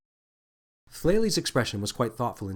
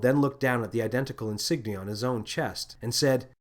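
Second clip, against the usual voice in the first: ordinary delivery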